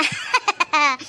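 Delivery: short burst of laughter; gloating laugh. Acted. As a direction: laugh as a lover sure of winning. Lovers gloating over their love.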